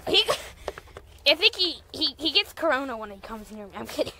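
ordinary talk, nothing marked

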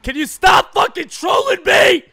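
loud, exasperated shout at the game; frustrated yell. Real, not acted. Gamer yelling at EA over bad packs, exasperated.

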